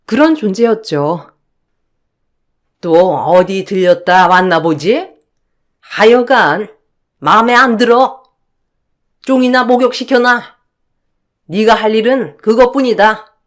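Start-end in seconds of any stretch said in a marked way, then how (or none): 2.8-10.4 put-on a sniffy older woman looking down on her daughter-in-law; scornful mother-in-law. Mother-in-law dismissing her son's wife, scornful. Voices the mother-in-law's scolding. 11.5-13.4 dismissive mother-in-law. Acted. Mother-in-law gives an order.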